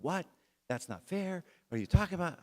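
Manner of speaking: put-on high-pitched, indignant kid's protest; incredulous complaint, voice jumping way up; protesting son. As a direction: imitate an outraged son objecting. Middle son protests unfair decision.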